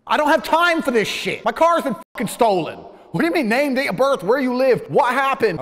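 loud, high, furious yelling at the police; furious outburst. Retold or imitated. To the policewomen about his stolen car, an angry shout. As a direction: blow up at the useless officers.

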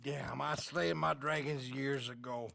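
put-on slurring, tired drunk voice; weary, drunken old man. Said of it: "you're drunk and you're bored".

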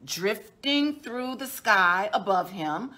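usual speaking voice

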